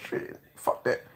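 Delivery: ordinary delivery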